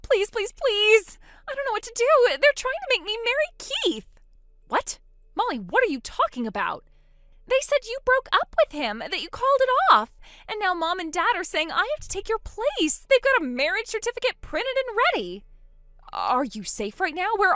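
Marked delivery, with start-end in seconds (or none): 0.0-1.2 high and louder with each please; desperate begging. Acted. Begging sister to answer phone. 1.5-15.4 high, frightened rush of words; panicked. Acted. Trapped bride pleading to sister.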